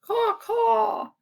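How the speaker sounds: high crow cawing; croaking crows. A narrator's reading. Let's hear it from children, crow cawing. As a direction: call out a high caw. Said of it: "The crows croaked".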